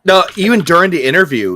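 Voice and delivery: ordinary delivery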